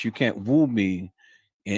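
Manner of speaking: ordinary delivery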